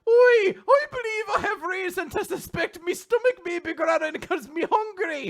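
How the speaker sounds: high, loud stage Irish brogue. Pretend. Hungry captain announcing his growling stomach in Irish. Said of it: "a stereotypical Irish guy".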